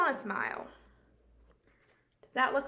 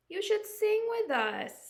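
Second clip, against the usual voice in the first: birds voiced high and louder; high, bright birds; 10 semitones higher, 5 dB louder than usual. The birds invite grumpy Jim to sing, high and cheerful. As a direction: chirp the invite high like a songbird.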